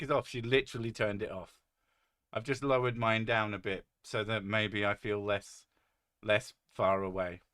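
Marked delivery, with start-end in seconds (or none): none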